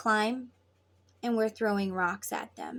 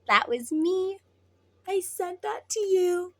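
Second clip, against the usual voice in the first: higher, teasing confession; playful reveal; 8 semitones higher than usual. Acted. Twin owning up to the sent feeling, playful. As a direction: own up in a high, playful voice.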